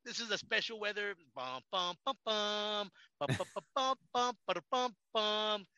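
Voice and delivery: slow, drawn-out mock newscaster; mock weather report. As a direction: announce the weather like a TV anchor. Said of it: "I'll be like".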